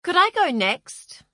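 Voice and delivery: high, loud and bold; loud interruption. A narrator's reading. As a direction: cut in loudly. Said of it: "A loud voice cut unexpectedly".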